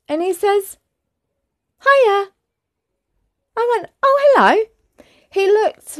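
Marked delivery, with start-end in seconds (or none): 1.8-2.3 high, strong sing-out greeting; acted-out cheery hello; stranger's greeting. 4.0-4.6 high, louder acted hello; retold startled hello; surprised greeting. Acting out own surprised reply.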